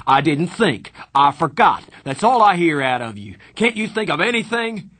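loud, high furious shout. A narrator's reading. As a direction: keep yelling at him. Darry keeps shouting.

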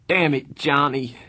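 high, loud, desperate cry; anguished begging. A narrator's reading. Begging a dead friend by the wall, anguished. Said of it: "he begged".

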